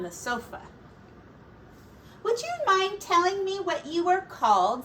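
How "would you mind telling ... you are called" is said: a touch higher, polite mother pig; lightly voiced Missus Pig. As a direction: ask the sitter politely. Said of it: "said Missus Pig".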